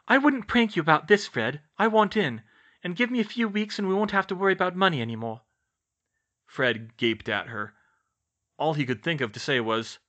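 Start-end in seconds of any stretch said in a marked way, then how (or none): 0.1-5.4 high, earnest, firm voice; keen, insistent appeal; determined young witch. A narrator's reading. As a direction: make your pitch in a raised high voice. Hermione asks to join.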